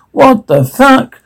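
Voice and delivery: high, slow shocked exclamation. Acted. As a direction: stretch it out in horror. Startled by rumbling and screams.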